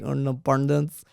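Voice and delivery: imitated Indian-accented independence. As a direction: mimic the guide's Indian accent. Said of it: "every time he gets like".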